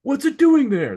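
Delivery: high, loud frantic question; imitating a panicked cry; imitated panic. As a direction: echo the film's fright. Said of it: "He just says".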